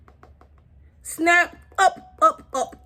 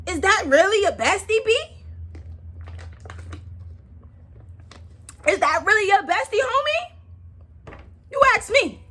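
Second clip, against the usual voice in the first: sassy mock question; 8 semitones higher than usual. Mocking the bestie, high voice.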